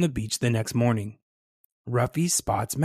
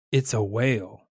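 plain reading voice

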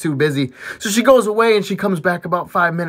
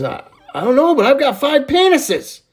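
laughing; 9 semitones higher, 5 dB louder than usual. Acted. Johnny laughing at doctor.

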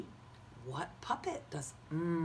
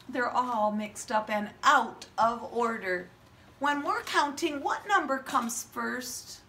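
put-on kids show host; 7 semitones higher, 6 dB louder than usual. Teacher shows mock surprise.

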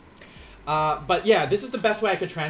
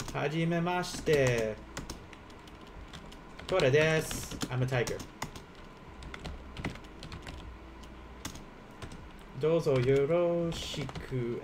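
slow demo; 5 dB quieter than usual. Shown as a demonstration. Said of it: "I could say, like".

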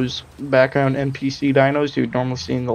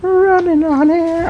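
panicked; 5 dB louder than usual. Real, not acted. Panicked game moment.

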